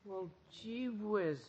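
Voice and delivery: soft, slow dejection; deflated teenage bewilderment; crestfallen. Acted. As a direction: let the disappointment sink in quietly. Archie stunned by broken date.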